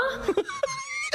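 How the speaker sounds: a squealing burst of laughter; high squealing laugh. Real, not acted. Laughing at the accent.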